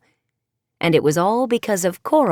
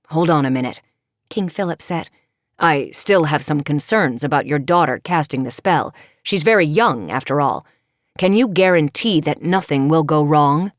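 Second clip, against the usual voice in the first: lower, firm kingly voice; a worried father king objecting; King Philip; 4 semitones lower than usual. Acted. King Philip to the sea witch, low and concerned.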